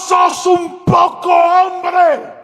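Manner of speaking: high, loud shout, dragged out slowly; shouting at the attacker. Retold or imitated. Pastor shouting at the knife-wielding man, loud high challenge. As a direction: yell it in his face, daring him.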